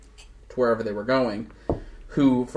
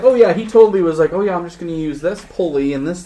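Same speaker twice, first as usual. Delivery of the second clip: excited retelling; 5 semitones higher, 5 dB louder than usual. Retold or imitated. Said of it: "we were like".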